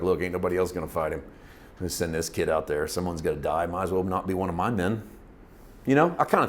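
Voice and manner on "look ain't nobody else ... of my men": low, quiet, offhand reasoning; resigned aside. Retold or imitated. King privately deciding to send the kid, low and resigned. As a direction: think aloud softly, shrugging it off.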